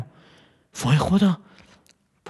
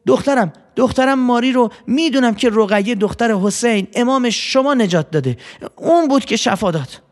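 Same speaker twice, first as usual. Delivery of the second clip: higher, bright telling of the miracle; happy and loud; 8 semitones higher than usual. Acted. Mother tells neighbours about her daughter's healing, happy and higher. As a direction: proudly announce the healing to everyone. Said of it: "با خوشحالی و با صدای بلند".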